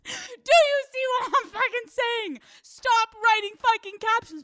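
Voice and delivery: high-pitched, worked-up comic outburst; exasperated shout. Pretend. Host scolding dog-caption writers, exasperated shout. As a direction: shout it in mock outrage. Breaks off parody to scold owners.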